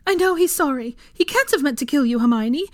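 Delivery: loud, high, desperate insistence; pleading. A narrator's reading. Mother defending Ron to Hermione, desperate pleading. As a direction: beg them to believe it. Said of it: "Molly pleaded".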